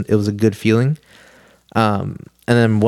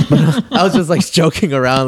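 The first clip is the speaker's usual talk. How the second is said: laughing through the words, voice pitched up; 6 semitones higher than usual. Real, not acted. Telling friends the fainting story, real laughter breaking in. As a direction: chuckle your way through it.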